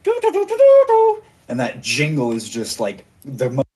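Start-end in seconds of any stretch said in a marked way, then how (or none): 0.0-1.2 very high, tootling do-do-do like a recorder; high sung recorder noise. Acted. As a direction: toot the jingle in a shrill-free high pipe. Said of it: "he starts twiddling away".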